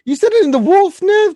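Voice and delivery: high, loud exclamation; squeaky excited cry; excited outburst. Real, not acted. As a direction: burst out in delighted disbelief. Excited outburst at the Wolf reveal.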